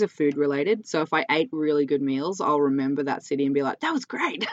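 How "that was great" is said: high, soft, dreamy "that was great"; mock delighted quote. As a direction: sigh it out like a happy food memory. Recalling a good meal.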